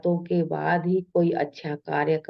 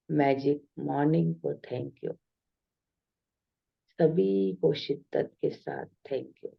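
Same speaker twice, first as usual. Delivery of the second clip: meditative thanksgiving; 4 dB quieter than usual. Real, not acted. Meditation leader thanking earth, water, fire and air, softly reverent. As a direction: offer each thanks quietly, pausing after every one.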